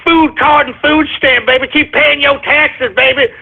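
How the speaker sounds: lightly voiced taunting freeloader; mocking welfare cases. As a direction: mimic the mockers taunting him. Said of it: "They were laughing at me, you know, saying".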